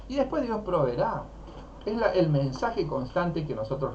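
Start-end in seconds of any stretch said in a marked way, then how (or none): 0.1-1.2 mock sales pitch to believers. Mocking message to believers.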